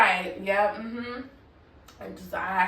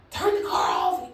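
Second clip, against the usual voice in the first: higher-pitched barked order, imitating the cop; retold aggressive shout; 7 semitones higher than usual. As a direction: bark it like the officer getting aggressive. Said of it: "he gets aggressive".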